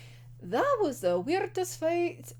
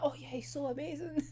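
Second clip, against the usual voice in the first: much softer, sarcastic swooning voice; mocking gush; 3 semitones higher, 11 dB quieter than usual. Mocking Atsushi's praise of his old enemy, softer and sarcastic. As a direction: sneer at the fawning praise. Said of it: "be like".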